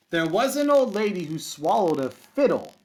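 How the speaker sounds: lively singsong read-aloud for kindergarten; picture book storytime voice; reading to children. Acted. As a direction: read it with a bouncy rhythm for little kids. Teacher reads picture book aloud.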